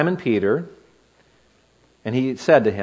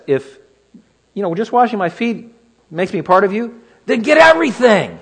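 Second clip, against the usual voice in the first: eager Peter; 7 semitones higher, 4 dB louder than usual. Acted. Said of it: "Because that's Peter".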